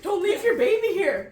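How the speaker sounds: high, loud call after the mama deer; calling out across the yard; pleading call. Retold or imitated. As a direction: shout after the deer not to leave.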